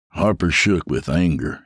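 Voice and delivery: plain reading voice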